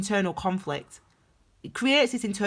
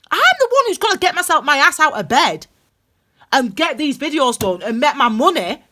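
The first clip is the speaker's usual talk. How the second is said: fired up, much higher and louder; getting irate; 8 semitones higher, 7 dB louder than usual. Real, not acted. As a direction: rant it out, high and loud and worked up. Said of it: "'cause I'm getting a bit irate".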